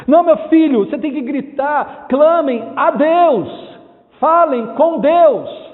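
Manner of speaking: impassioned pulpit call, slowing at the end; forceful exhortation. Real, not acted. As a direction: urge them loudly, stretching the final commands. Preacher exhorts people to cry out.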